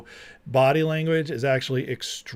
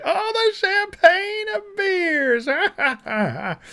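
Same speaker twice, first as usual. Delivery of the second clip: much higher, very slow, wailing mockery; hammed-up mock Kamala; much slower than usual. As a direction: stretch "champagne" into a fake sob. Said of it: "She's like".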